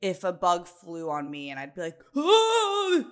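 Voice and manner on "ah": high, loud startled cry; dramatic freak-out. Retold or imitated. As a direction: overreact loudly to a bug, high-pitched. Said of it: "call me dramatic all the time".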